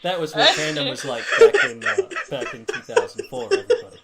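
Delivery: put-on high laughing old geezer voice; cranky old man ranting about fandom. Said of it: "Ben is very good with old man voice".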